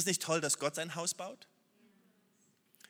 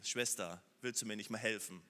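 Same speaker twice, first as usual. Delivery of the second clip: lower, softer sisterly request; gentle ask; 8 semitones lower, 4 dB quieter than usual. Pretend. Martha gently asking her sister for help, lower and softer. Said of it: "hätte sie ja selber sagen können".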